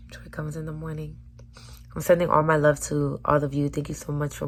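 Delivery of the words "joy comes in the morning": soft whispered promise; hushed whisper. Shown as a demonstration. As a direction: breathe it like a secret. Repeats line as whisper.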